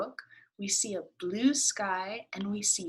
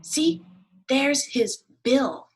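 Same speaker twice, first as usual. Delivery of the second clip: higher and louder duck, slow; excited duck; 6 semitones higher, 10 dB louder, much slower than usual. Acted. Duck showing the class its bill, higher and louder. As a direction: point it out eagerly as the duck.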